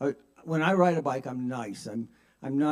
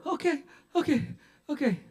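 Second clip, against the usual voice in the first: whimpering surrender; 12 semitones higher than usual. Acted. Driver threatened with broken arm, whimpering surrender. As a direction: give in quickly, in pain. Pinned driver gives in again.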